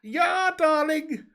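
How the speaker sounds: put-on high, loud, long-drawn German accent; hammed-up German catchphrase voice. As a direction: stretch it out in a high-pitched comic German voice. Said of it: "the German accent".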